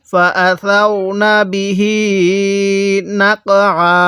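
measured chant with the last "hee" of বিহি held; slow Quran recitation. Shown as a demonstration. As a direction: recite slowly, stretching the end of বিহি. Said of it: "আমার সাথে পড়ুন".

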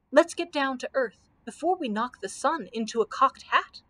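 ordinary delivery, nothing marked

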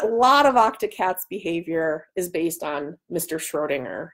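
ordinary talk, nothing marked

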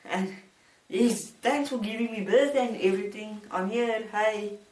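high squeaky voice of a newborn; newborn baby voice. Pretend. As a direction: play the just-born baby, high and bright. Thanks for giving me birth.

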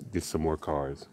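usual speaking voice

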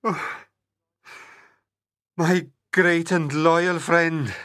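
slow, low, grieving prince; crying prince. A narrator's reading. Prince holding his dead dog, understated crying. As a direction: lament slowly in a deep voice.

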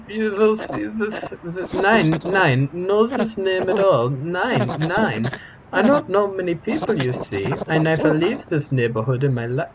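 high, hammed-up accented denial; flustered foreign-accented character. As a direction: deny everything in a thick foreign accent. Voices a game character.

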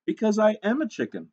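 lightly voiced eager chicken letter-writer; slightly hammed-up hopeful author; hopeful hen author. Reads the hen's letter.

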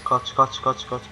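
soft, urgent hushed repeats; whisper-quiet urging to run. Real, not acted. Urging himself to flee, hushed. (fısıltıyla acele)

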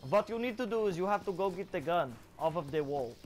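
put-on German accent giving instructions; thick comic German accent; mock German accent. Shown as a demonstration. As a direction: order them about in a mock German accent. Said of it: "I can do, uh, German".

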